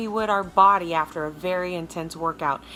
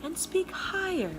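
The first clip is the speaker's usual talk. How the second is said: much higher, bright sing-song Mary Poppins voice; 11 semitones higher than usual. Shown as a demonstration. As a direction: lift it up like a cheery nanny. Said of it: "Speak in your Mary Poppins voice".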